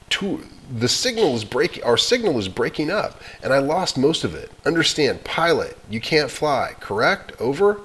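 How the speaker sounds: brisk, urgent radio check; radio operator voice. Acted. Operator confirming the boy can't fly, urgent.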